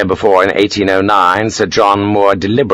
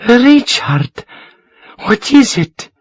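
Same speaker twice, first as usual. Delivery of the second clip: Josefina; slower than usual. A narrator's reading. Josefina seeing the gold.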